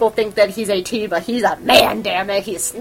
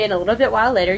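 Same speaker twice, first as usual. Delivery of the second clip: ordinary delivery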